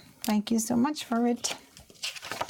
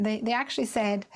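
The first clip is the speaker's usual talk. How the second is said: ordinary talk, nothing marked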